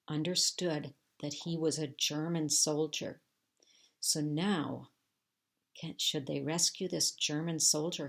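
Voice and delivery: ordinary delivery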